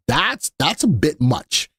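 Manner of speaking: ordinary delivery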